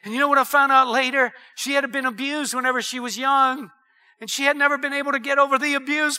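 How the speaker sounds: ordinary talk, nothing marked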